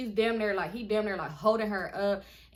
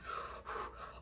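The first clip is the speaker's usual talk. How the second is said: long unvoiced puff of breath; imitated smoking; 12 dB quieter than usual. Shot boy smoking on the floor, imitated exhale. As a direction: blow out pretend cigarette smoke. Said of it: "sitting there smoking a cigarette".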